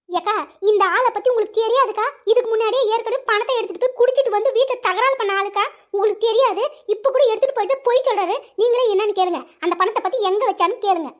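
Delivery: loud, heated complaint about a husband; angry accusation. Acted. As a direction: accuse him loudly to the neighbour. Wife accuses husband before neighbour.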